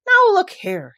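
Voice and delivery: loud, high, sharp warning; sudden cross outburst. A narrator's reading. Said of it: "he said sharply".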